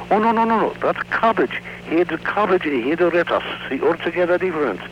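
put-on very high, insistent Japanese-accented grocer; comic Japanese accent, rapid high protest; Japanese dialect. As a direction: correct the customer in a high caricature Japanese accent. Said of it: "that's a Japanese dialect".

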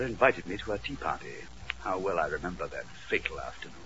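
low, soft, grave mystery narration; hushed confession of a condemned barrister; Norbert Nottingham narrating. Acted. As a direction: tell the tale low and solemnly.

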